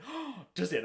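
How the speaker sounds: imitating wife. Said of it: "She went".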